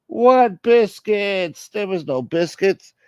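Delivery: high, drawn-out put-on holler; exaggerated high-pitched complaint; high mock outcry. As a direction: stretch it out high and loud, then ease off.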